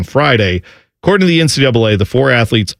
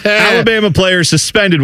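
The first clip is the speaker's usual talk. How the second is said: clickbait headline; 7 semitones higher than usual. Shown as a demonstration. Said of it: "you know, like".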